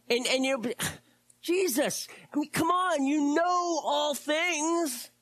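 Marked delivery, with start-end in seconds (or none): 1.4-5.1 high, slow, hurt protest; exasperated Peter. Retold or imitated. Preacher voicing hurt Peter pleading with Jesus. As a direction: plead as if wounded, much higher. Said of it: "You may not have said it quite that way".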